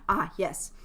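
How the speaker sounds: normal talking voice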